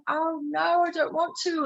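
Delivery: high English-accented mock reluctance. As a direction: refuse coyly in a put-on English accent. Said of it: "anytime I talk like this".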